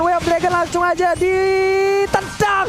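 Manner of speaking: building live esports play-by-play; rising hype commentary call; esports caster. Acted.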